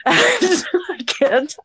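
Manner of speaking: usual speaking voice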